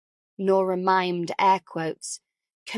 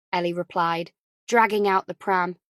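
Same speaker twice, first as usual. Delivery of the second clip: ordinary delivery, nothing marked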